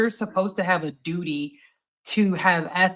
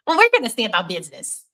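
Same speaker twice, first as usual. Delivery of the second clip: nerdy voice; 3 semitones higher, 3 dB louder than usual. Shown as a demonstration. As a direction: play it like a timid nerd. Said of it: "I wanna do this in my nerdiest, my nerdiest, uh, voice possible".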